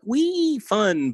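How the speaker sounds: high, drawn-out squeal of wee; sing-song mock excitement; mock glee. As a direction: squeal it like a kid on a slide. Mimes shallow fun in quote.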